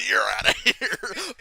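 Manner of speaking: high, loud, slow ejection call through laughter; laughing imitation of a ref's ejection; mock referee call. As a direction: draw it out like a showboating ref.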